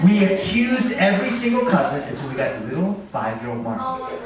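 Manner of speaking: ordinary delivery, nothing marked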